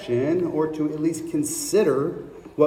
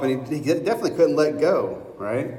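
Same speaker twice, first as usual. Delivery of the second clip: ordinary delivery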